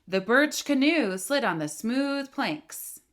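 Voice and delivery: slow, pressing all the way through; slow steady push. Shown as a demonstration. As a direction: drag it out evenly to the end. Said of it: "I'm moving my finger pretty slowly".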